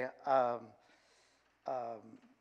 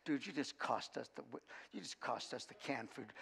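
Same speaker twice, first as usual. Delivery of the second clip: much softer, muttered aside; under his breath; 4 semitones lower, 14 dB quieter than usual. Retold or imitated. Coach's unspoken thought to the boy, muttered softly. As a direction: say what you'd never say aloud, softly. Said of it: "I wanted to say".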